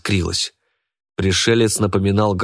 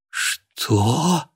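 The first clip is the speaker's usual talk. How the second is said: sudden high startled "what"; shocked question; 6 semitones higher than usual. A narrator's reading. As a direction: caught off guard, thrown. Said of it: "переменился в лице Кайратов".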